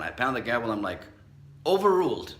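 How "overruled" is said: a loud, higher judge's ruling. Pretend. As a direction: slam it down like a judge. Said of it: "Just like a judge would say".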